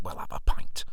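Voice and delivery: conspiratorial whisper giving in; caving in with a sneaky whisper. Retold or imitated. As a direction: whisper it like a guilty secret.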